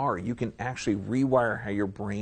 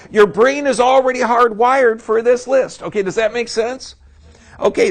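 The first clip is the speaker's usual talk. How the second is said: much higher and louder, a revival preacher whipping up the room; fired-up preaching; 10 semitones higher, 11 dB louder than usual. Acted. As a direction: shout it to the back rows with full fervor.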